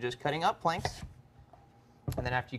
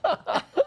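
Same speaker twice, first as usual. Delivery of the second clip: burst of laughter; 7 dB louder than usual. Real, not acted. Laughs at a joke.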